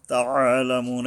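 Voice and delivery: তাআলামুন with a squeezed "'a", never a plain a; throaty ayn. Shown as a demonstration. Teacher warns about meaning, তাআলামুন with careful throaty ayn. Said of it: "এই আইন যদি উচ্চারণটা ঠিক না হয়".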